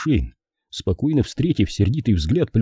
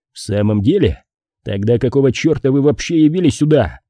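ordinary delivery

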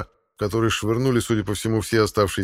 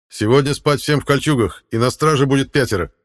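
firm loud order; 5 semitones higher, 6 dB louder than usual. Acted. Leader gives night orders.

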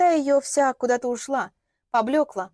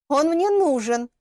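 slow, higher, tense admission; through gritted teeth; 5 semitones higher, 5 dB louder, slower than usual. A narrator's reading. Лилиана admits she needs Вадим, through gritted teeth. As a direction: force the words out slowly and firmly through gritted teeth. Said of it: "сквозь зубы сказала она".

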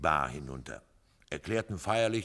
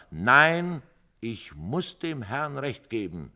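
firm and stern, a touch higher; 8 semitones higher, 8 dB louder, slower than usual. A narrator's reading. Stern old man siding with the stranger. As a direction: Finnegan sternly taking sides. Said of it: "sagte Finnegan streng".

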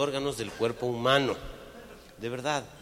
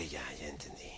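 whisper; 9 semitones lower, 13 dB quieter than usual. Acted. Susurro del hombre en el chiste.

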